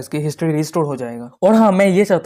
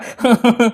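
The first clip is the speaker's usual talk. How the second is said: short acted sheepish giggle; awkward laugh; 4 semitones higher than usual. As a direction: put on a brief cover-up laugh. Laughing off the child's remark. (झेंपी हुई छोटी हँसी)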